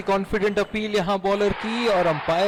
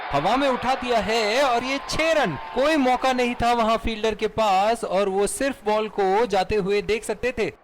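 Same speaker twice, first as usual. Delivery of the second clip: excited cricket commentary; 6 semitones higher than usual. Acted. Six called in live commentary.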